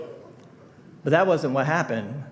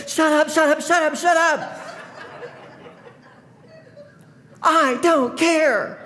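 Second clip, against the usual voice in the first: retold yelling; 11 semitones higher than usual. Breaking up the fight, mock yelling shut up. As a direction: bark it over them to cut them off. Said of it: "I'm going".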